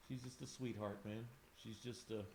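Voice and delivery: barely holding it together, voice sinking; choked up. Real, not acted. Artist tells viewer about her late dog, softly choked up. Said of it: "you got me tearing up".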